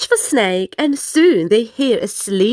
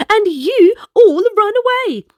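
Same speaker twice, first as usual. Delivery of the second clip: playful snake; 9 semitones higher than usual. Acted. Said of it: "says Snake".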